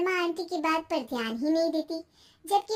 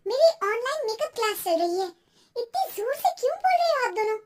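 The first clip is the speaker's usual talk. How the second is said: higher, irritated wife's voice; exasperated young housewife, pitched up; annoyed wife; 7 semitones higher, 5 dB louder, faster than usual. Acted. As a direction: complain about the noise interrupting your class.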